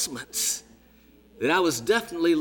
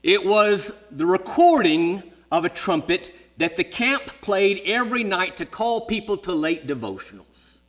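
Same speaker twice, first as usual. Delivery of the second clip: usual speaking voice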